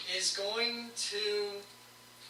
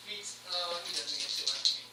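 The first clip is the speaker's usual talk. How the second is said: ordinary talk, nothing marked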